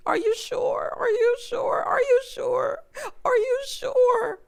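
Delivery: high, crying repeated plea; imitating her crying plea. Retelling Marissa begging Ramses on the show, high and tearful. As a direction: echo her desperate, tearful pleading. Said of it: "she kept saying".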